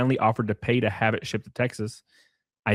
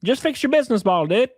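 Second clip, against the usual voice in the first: high, fast smug mock of the advice; mocking the partner; 10 semitones higher than usual. Mocking the partner's advice to Sydney, high and fast. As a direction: toss it off like a smug know-it-all.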